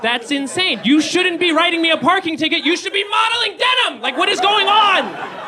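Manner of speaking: high, loud laughing shout; comic yell. Real, not acted. Ranting at parking meter cops, high and loud shout. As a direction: yell it up high in mock outrage.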